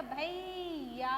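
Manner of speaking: भैया stretched slowly as bha-ee-ya; slow, clearly separated भैया; clear drawn-out ai. Shown as a demonstration. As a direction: hold the ai of भैया very long. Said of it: "अच्छे से clear करना है".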